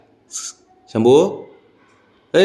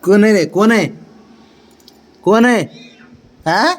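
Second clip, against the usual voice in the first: high pet talk; 11 semitones higher, slower than usual. Real, not acted. Man greeting his excited dogs at the door, high sing-song pet talk. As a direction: gush over the excited dog in a high voice.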